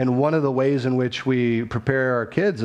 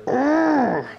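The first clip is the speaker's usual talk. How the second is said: frustrated groan; 13 semitones higher than usual. Retold or imitated. Son groaning at his mom's explanation, frustrated. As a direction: groan like a fed-up nine-year-old.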